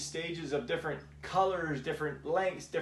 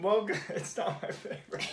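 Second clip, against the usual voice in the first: laughing through a higher-pitched confession; amused admission; 5 semitones higher than usual. Real, not acted. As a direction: admit it with a laugh. Laughs over disliking the whiskey.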